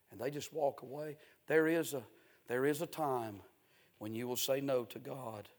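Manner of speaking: ordinary talk, nothing marked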